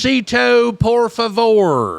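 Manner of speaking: put-on high, slow Spanglish accent. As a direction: ham up a Spanglish order at a taqueria. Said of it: "saying English words with a Spanglish accent".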